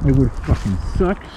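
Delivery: ordinary talk, nothing marked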